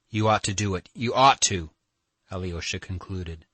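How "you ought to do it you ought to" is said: "ought" hit hard, louder on the repeat; stress on "ought". A narrator's reading. Alyosha urging Katya to go, immense stress on "ought". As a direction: press heavily on the word "ought" each time. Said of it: "laying immense stress on the word ought".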